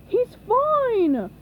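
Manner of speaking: high, loud insistence; cry. A narrator's reading. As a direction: cry out in denial. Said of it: "cried Patrick".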